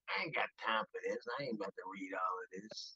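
low, hushed grumble under the breath. Retold or imitated. Honoree griping about reading his accolades, low grumble. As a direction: mutter it low, refusing to bother. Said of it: "Bill's like".

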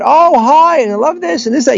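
high-pitched mock restroom chatter; chattering women. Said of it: "they'll be talking and chattering about".

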